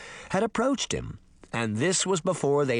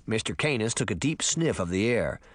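ordinary delivery

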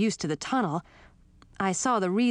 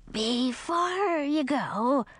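very slow raised polite skull; 7 semitones higher, much slower than usual. Acted. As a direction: stretch it out, creaky skull. Skull requesting.

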